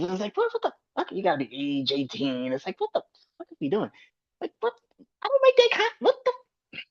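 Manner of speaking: high-pitched, sputtering disbelief; exasperated rant. Real, not acted. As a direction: let the voice jump up high, baffled and fed up. Ranting in disbelief at restrictions.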